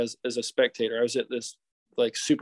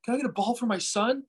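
begging fan; 8 semitones higher than usual. Retold or imitated. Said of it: "He's like".

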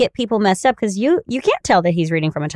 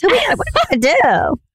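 sassy rush; 8 semitones higher, much faster than usual. Acted. Fast sassy rattle.

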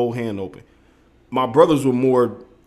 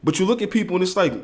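ordinary talk

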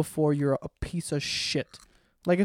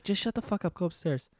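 softer retold order, trailing down; hushed scolding; 7 dB quieter than usual. Sending drunk friend upstairs without waking mom, hushed. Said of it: "trying not to wake my mom".

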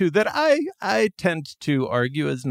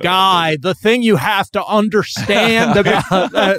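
higher, louder jokey American accent put on by a New Zealander; second-hand American accent impression, raised and loud; mock New Zealander doing American; 6 semitones higher, 6 dB louder than usual. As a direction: ham up a Kiwi's idea of an American accent. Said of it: "my impression of your impression of an American accent".

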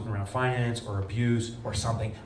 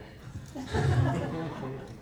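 choked laugh; 2 semitones higher, 3 dB quieter than usual. Real, not acted. After daughter's piggy bank story.

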